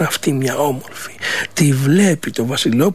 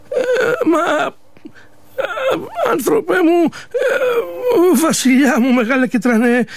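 put-on old man near tears, much higher, begging the king; a poor old man crying out that he has no one; pleading old man; 11 semitones higher than usual.

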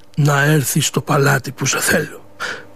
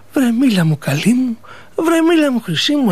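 prince pleading higher, tender and insistent; a young man on his knees begging his bride to speak; 7 semitones higher than usual. Acted. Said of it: "Την παρακάλαγε νύχτα μέρα γονατιστός".

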